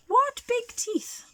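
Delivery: put-on high, slow worried girl; little girl voice. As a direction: gasp it like a small scared girl. Girl's worried thought, very high.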